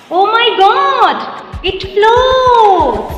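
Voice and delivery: high, loud, delighted gasp of surprise; thrilled to see it float; excited exclaim. Real, not acted. Sees the cap float, exclaims.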